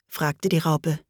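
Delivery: usual speaking voice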